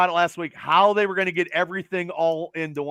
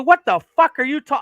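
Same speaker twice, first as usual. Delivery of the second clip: louder angry retelling, breaking off mid-word; retold angry outburst; 5 semitones higher, 7 dB louder than usual. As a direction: blurt it out like you're losing it again. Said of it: "I went off".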